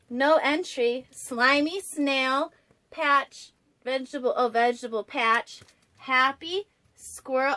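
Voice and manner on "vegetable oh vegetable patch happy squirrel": plain reading voice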